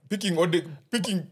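ordinary talk, nothing marked

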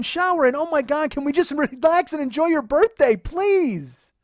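high, loud tirade; exasperated ranting. Retold or imitated. Boyfriend imagining his rant to his girlfriend, exasperated shouting. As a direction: rattle off the list at a pleading yell.

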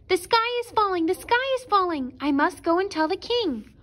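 frantic hen raising the alarm; alarmed cry. A narrator's reading. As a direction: shout the alarm while running. Hen runs shouting the news.

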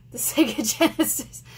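tripping over the name through a laugh; laughing stumble. Real, not acted. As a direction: giggle while trying to get the name out. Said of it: "I can't talk".

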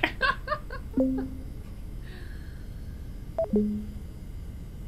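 high, soft laughter trailing on; real laughing fit. Real, not acted. Streamer laughing about their emojis not being art, high giggles. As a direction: giggle helplessly, high and quiet.

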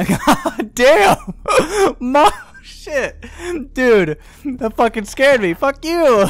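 high, loud laughing after a fright; shaken laughing. Real, not acted. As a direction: laugh off the scare, still shaken. Recovering from jump scare.

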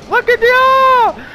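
high, loud and slow delighted cry; excited. Real, not acted. Gamer thrilled to see all the gnomes arrive. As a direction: stretch it out high with joy.

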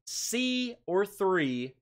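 slow deliberating. Real, not acted. Torn between two guesses.

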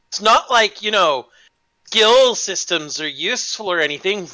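usual speaking voice

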